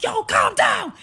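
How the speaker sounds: high, very loud yell; angry shout. Retold or imitated. Date yelling at panicking woman through the door, shouting. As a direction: shout the order full out.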